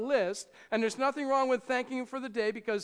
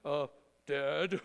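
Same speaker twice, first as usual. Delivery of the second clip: mock-solemn, lower pitch; stiff holy voice; 7 semitones lower than usual. Preacher mocking a formal prayer to a father, lower and stiff. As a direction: say it like a formal prayer to your dad. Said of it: "Who, who talks to their father like that?".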